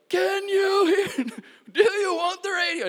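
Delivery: high, slow, loud delighted shout with laughter; excited yelling. Retold or imitated. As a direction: shout the question, laughing with joy. Retesting the healed deaf man.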